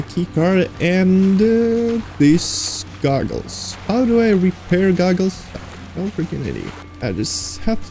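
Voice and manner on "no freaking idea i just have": muttering. Real, not acted. Muttering to self, confused.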